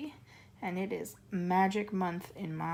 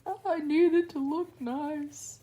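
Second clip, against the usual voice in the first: higher, slow fretting dad voice; mock worried dad; 8 semitones higher, 3 dB louder than usual. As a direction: whine it like a worried father. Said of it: "my dad's freaking out because he's like".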